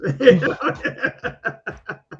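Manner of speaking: loud laugh that trails down; real burst of laughter. Real, not acted. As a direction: let the laugh roll out and fall away. Laughs after his own joke.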